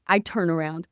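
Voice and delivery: ordinary talk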